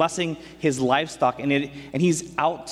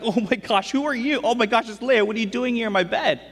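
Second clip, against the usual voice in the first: much higher, rising panic, faster at the end; shocked disbelief; 9 semitones higher than usual. Retold or imitated. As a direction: yelp it like waking beside the wrong bride. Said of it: "And he's like".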